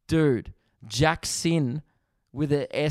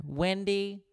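high, softer roll-call voice; teacher calling roll; 6 semitones higher, 5 dB quieter than usual. Acted. Teacher working down the alphabetical register, high soft roll-call voice. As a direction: call the next name lightly and high.

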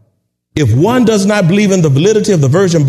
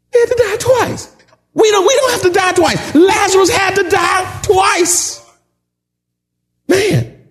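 fervent preaching shout; 11 semitones higher than usual. Real, not acted. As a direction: hammer it home to the congregation. Preacher's climax on dying twice.

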